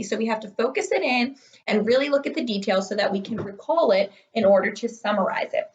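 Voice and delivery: ordinary talk, nothing marked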